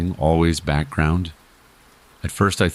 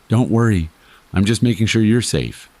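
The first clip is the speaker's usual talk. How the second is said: higher, easy and offhand; casual reassurance; 6 semitones higher than usual. A narrator's reading. Watching stranger answers the frightened narrator, casually reassuring. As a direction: toss off the reassurance in a raised, casual voice. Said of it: "in the most casual voice I've ever heard".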